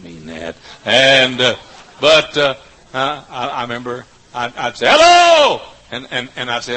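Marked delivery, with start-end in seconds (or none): 4.9-5.6 loud, much higher shouted hello. Retold or imitated. Preacher greeting the crowd in the dark, shouting.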